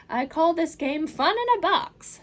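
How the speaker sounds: put-on the Cat in the Hat calming the children, big and upbeat. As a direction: reassure the kids with a grand flourish. Cat announces game.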